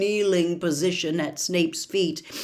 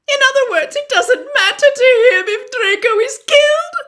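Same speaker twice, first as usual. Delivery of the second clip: high, loud, crying question; disbelieving through tears; Narcissa, anguished; 11 dB louder, faster than usual. A narrator's reading. Narcissa challenges Snape, crying and high.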